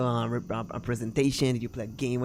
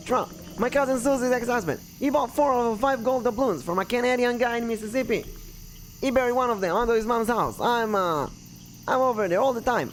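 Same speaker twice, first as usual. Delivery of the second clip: high-pitched, chatty character voice; rambling local; 10 semitones higher than usual. Acted. Local explaining his cousin's doubloons and batteries, rambling. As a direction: ramble on in a raised voice.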